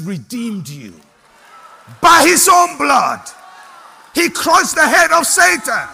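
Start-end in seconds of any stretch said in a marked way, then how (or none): 2.0-5.9 higher and louder, triumphant; preaching shout. Real, not acted. Preacher to congregation, triumphant shout. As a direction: shout the victory to the church.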